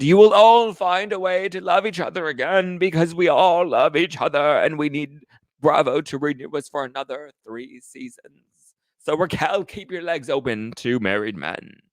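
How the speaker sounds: grand, high, theatrical toast-giver; Lisa Vanderpump. Acted. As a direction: proclaim a lofty speech, stretching the vowels. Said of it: "she gives her speech, and she's like".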